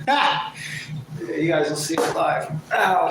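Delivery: sudden yelp with a laugh; loud startled yell in pain; screaming while falling. Real, not acted. Nearly falling off chair.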